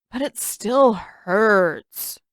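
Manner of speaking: whining. A narrator's reading. Han whining after treatment.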